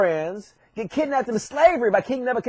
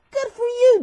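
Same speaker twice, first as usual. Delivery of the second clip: much higher admiring coo; high-pitched praise; 12 semitones higher than usual. Acted. Lions congratulating Daniel, high-pitched praise.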